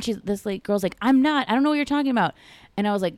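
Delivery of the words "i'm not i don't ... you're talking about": high, imitated young woman's protest; protesting denial. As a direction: deny it in a raised voice. New girl denies the accusation.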